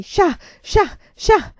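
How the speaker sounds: high, loud rhythmic chant-like shouts; grido cadenzato. A narrator's reading. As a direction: shout each one separately, like a menacing mob's cry. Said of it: "Le grida diventarono sempre più forti". (grida cadenzate e acute)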